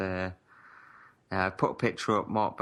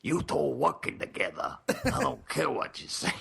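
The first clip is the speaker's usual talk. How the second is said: police captain voice; 4 semitones higher than usual. Pretend. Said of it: "The police captain's play".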